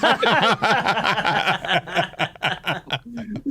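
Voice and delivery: high ringing laugh; hearty laugh. Real, not acted. Laughing at the story.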